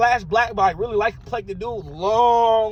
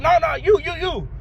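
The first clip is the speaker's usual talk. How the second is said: higher-pitched retold insistence; mock-eager, repeating it in a raised pitch; insistent; 6 semitones higher, 3 dB louder than usual. Drunk guy picking the storyteller to teach pool, insistent.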